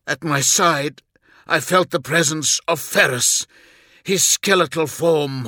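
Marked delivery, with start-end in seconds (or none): none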